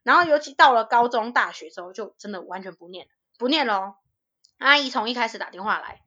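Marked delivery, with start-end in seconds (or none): none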